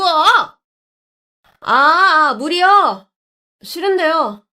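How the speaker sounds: loud cheeky comeback; shouting back. Acted. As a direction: yell over the noise, refusing. Shouting back over the shower.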